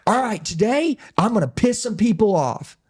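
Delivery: a hint of a fired-up preacher. Preacher opening his first service, preacher voice. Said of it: "preacher talk just like this".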